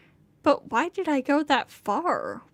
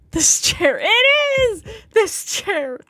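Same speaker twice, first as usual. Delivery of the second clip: loud, high-pitched angry yell; furious yell leaping up high; enraged outburst; 8 semitones higher, 9 dB louder than usual. A narrator's reading. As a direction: blow up at the chair. Acted rage at the chair.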